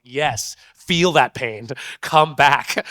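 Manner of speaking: loud, urgent calling out to wake her; urgent yelling. Retold or imitated. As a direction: yell at her to come back. Urging unconscious girl to wake.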